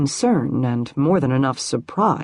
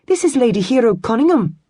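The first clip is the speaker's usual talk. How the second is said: put-on eager young maid, fast and bright; eager to please; 8 semitones higher, 4 dB louder, faster than usual. A narrator's reading. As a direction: hurry to be helpful. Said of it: "seeming eager to please".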